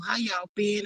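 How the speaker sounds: ordinary talk, nothing marked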